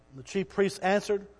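normal talking voice

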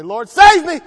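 loud, high pleading shout; desperate cry for help. Pretend. Sinking Peter cries out to the Lord, shouting. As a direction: yell it like a man going under the waves. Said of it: "some of us are too proud to say".

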